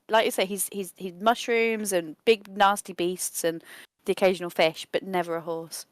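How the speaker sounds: normal talking voice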